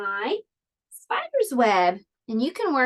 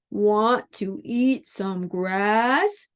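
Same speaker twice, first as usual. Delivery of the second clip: slow, drawn-out cow question; 2 semitones lower, much slower than usual. Acted. Cow invites spider to eat grass, slow mooing voice. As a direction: stretch the words out like a moo. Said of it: "said the cow".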